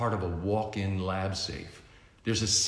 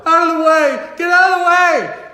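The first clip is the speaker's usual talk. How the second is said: high loud screaming crowd; panicked shouting; 15 dB louder than usual. Acted. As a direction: yell in terror. People fleeing robot.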